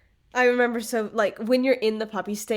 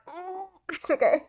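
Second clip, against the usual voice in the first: high-pitched puppy-cooing voice; cooing over a puppy; 10 semitones higher than usual. Retold or imitated. Puppy owner melting at a lookalike pup, cooing. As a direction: coo it high and small.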